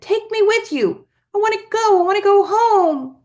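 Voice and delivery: high, loud desperate wail; child shouting. A narrator's reading. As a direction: scream to be taken home. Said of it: "he shouted".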